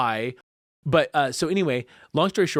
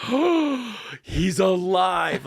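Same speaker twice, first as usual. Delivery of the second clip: high gasped "oh", then stretched-out amazement; retold astonishment, starting high and easing down; amazed; 5 semitones higher, 3 dB louder, much slower than usual. Man retelling seeing his cousin revive, amazed.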